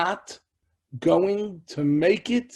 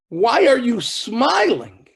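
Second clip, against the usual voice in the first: high, loud, drawn-out question; incredulous challenge; 8 semitones higher, 8 dB louder, slower than usual. Retold or imitated. Weeping disciple asking why his Rebbe smiles, incredulous. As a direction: demand it in hurt bewilderment.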